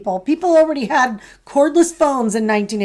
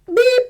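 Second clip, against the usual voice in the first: sudden high-pitched beep; squeaky little "beep" burst; imitated stutter beep; 13 semitones higher, 4 dB louder than usual. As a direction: mimic an involuntary verbal tic. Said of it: "and he would go".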